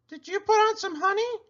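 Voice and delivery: a llama giving friendly advice; Cherry Sue voice. A narrator's reading. Llama advising her friend the pig, high and sweet. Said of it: "asked Cherry Sue".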